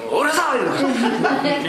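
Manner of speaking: high, loud, slow stretched-out shout; drawn-out monk yell. Retold or imitated. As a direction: stretch the yell out long to scold them, laughing. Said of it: "Nobody wants to get screamed at".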